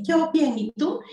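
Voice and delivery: eco agudo del niño, copia la respuesta; niño repitiendo. Shown as a demonstration. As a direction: repite la frase como eco infantil. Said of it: "Y él dice". (el niño repite en eco, voz aguda)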